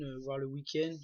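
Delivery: normal talking voice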